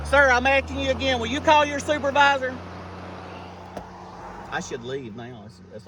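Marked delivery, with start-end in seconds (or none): none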